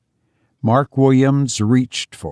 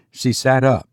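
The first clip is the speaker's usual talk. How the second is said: usual speaking voice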